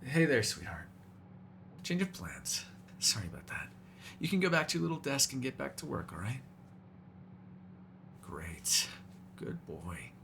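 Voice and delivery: quiet, syrupy reassurance; soft coaxing. Acted. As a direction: soothe them gently and send them away. Soothing the human, sending him off.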